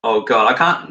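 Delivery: ordinary delivery, nothing marked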